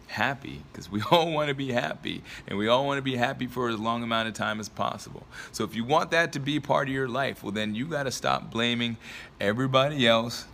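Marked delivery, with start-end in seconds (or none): none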